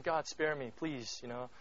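soft, fearful begging; quiet pleading prayer; hushed plea. Retold or imitated. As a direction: beg for mercy under your breath. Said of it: "almost in that place saying".

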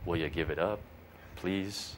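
soft, low, patient plea; gentle, hushed coaxing; patient request. Retold or imitated. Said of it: "God patiently says".

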